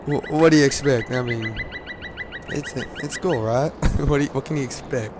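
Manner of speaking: ordinary talk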